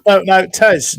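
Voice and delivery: high, loud "no, no"; loud protest. Real, not acted. Host protests to Tez, loud and high. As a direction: call him out loudly.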